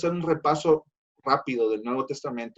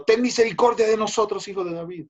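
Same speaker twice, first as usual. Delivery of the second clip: crying out; 7 semitones higher, faster than usual. Retold or imitated. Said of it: "dando voces y diciendo".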